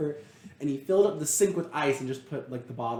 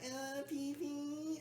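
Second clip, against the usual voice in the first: put-on very slow, much higher and softer kid voice; little kid voice; 12 semitones higher, 14 dB quieter, much slower than usual. Mocking grown-ups who use kid words, high and slow. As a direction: say it like a toddler. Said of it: "little kid version".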